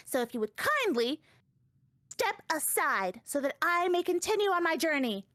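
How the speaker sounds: put-on high, haughty little-girl voice; sassy child character. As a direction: play her as a smug, secretive brat. Sassy child states revenge.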